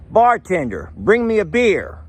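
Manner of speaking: high, demanding order for a beer; tough-guy joke customer; with attitude. Acted. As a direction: swagger in and demand it. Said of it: "with some attitude".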